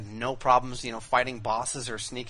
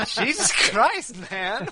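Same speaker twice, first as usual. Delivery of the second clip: loud, high, drawn-out amazement; amazed laughing outburst; 12 semitones higher, 9 dB louder, slower than usual. Real, not acted. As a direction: cry out in disbelief, laughing. Amazed reaction to impression.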